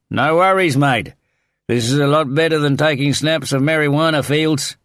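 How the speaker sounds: high, loud cheery-worded holler; shouting back. A narrator's reading. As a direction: yell a casual reply over the wind. Pilot shouting back over wind roar.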